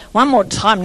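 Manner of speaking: ordinary delivery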